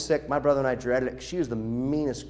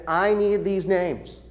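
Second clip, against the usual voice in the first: slow firm demand; 4 semitones higher, much slower than usual. Retold or imitated. Quoting Rosie's demand.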